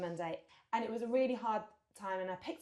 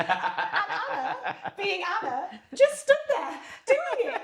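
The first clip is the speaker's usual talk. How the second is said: laughing through it, voice climbing high and loud; 10 semitones higher, 9 dB louder, much slower than usual. Real, not acted. Friend laughs telling host about his wife, laughing. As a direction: crack up while telling how Anna just went along with it.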